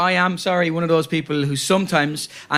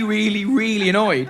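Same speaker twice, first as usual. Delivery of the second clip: usual speaking voice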